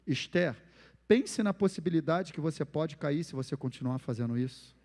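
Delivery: soft, patient correction of a daughter; quiet, calm parental advice; gentle warning. Shown as a demonstration. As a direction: reason calmly with her instead of yelling. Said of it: "Agora eu falo assim".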